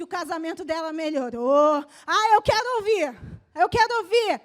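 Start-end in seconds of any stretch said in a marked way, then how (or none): 2.1-4.4 loud, driving sermon climax; fiery preaching. Real, not acted. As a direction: preach it hard to the church. Pastor preaching at full force. (pregação inflamada e forte)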